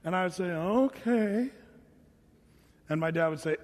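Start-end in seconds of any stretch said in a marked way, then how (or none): 0.5-1.5 reluctant drawn-out okay; grudging. Retold or imitated. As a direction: mimic a kid's unwilling, dragged-out agreement. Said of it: "that's not how you answer your mother".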